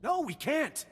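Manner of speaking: high-pitched, panicky refusal; alarmed protest. Acted. Alarmed protest of a risky fight.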